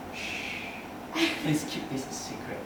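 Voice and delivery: hushed secret whisper, almost a laugh. Retold or imitated. Writing a crush's name in a diary, whispered. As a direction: lets the audience in on a secret.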